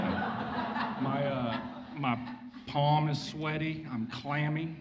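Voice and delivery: laughing through the words, a bit breathless; nervous chuckle at the podium. Real, not acted. Nervous speaker at the memorial, laughing through it. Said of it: "I'm shaking up here".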